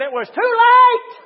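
sudden loud, high cry; full-force pulpit shout; shouted warning. Real, not acted. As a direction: hurl the refrain at the congregation. Shouted refrain.

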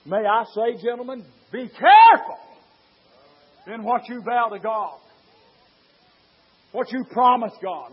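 loud, high preacher's cry building on the vow; full-force preaching. Acted. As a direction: preach it out to the whole church.

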